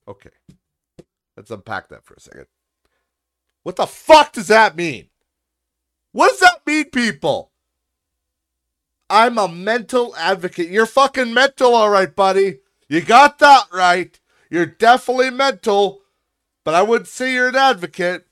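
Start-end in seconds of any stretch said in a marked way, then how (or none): 3.6-7.5 very loud, high-pitched outburst of disbelief; exasperated shout. Acted. Ranting at the baffling comment. 9.1-10.7 high, very loud mocking quote; mocking shout. Mockingly shouts back the comment. 10.7-18.3 high, very loud mocking retort; derisive shouting. Ranting back at the commenter.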